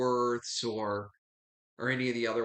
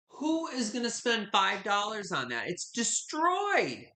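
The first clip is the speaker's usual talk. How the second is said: higher, incredulous, slowing right down at the end; retold incredulity; 7 semitones higher, slower than usual. Seller retelling her shock at the dish's price, higher and incredulous. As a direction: scoff at the price in disbelief. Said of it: "I'm like".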